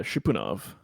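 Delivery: ordinary talk, nothing marked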